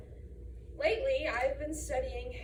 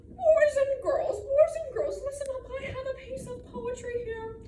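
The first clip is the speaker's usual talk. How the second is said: high, fussy club-president voice; high-pitched announcement to a gathering; Aunt Emmy; 7 semitones higher, 5 dB louder than usual. Acted. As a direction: address the children like a self-important aunt. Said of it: "My Aunt Emmy ... would have me".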